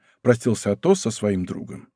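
usual speaking voice

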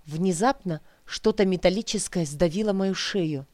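usual speaking voice

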